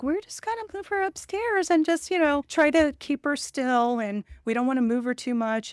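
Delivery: high, hushed imitation of the staff; mimicking hospital staff. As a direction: play the careful staff, voice lifted high.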